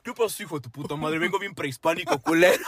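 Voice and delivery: high put-on prehispanic warrior; caveman. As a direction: growl it like a caveman. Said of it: "vatos prehispánicos".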